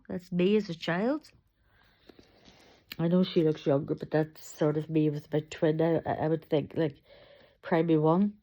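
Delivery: ordinary talk, nothing marked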